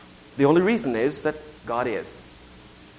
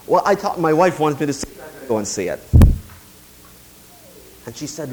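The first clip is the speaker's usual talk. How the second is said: normal talking voice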